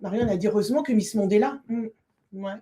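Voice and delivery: usual speaking voice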